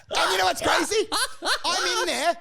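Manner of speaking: shrieking high laugh into a shout. Real, not acted. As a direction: burst out laughing and yell the next line. Bursting laugh then shout.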